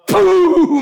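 a loud, high mouth-made blast; loud explosion noise. Retold or imitated. Preacher imitating the cow exploding, loud blast noise. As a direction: burst out with a boom sound.

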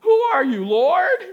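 high, loud, breaking cry of awe. Acted. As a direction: cry out in terror to the Lord. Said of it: "what would you say".

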